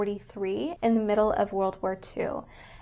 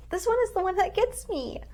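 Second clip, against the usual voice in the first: pitched way up, choking back tears; fighting tears as the words come; voice cracking up; 10 semitones higher than usual. Real, not acted. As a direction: voice breaking as emotion hits. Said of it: "I'm gonna cry".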